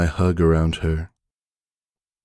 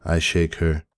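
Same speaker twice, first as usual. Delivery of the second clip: plain reading voice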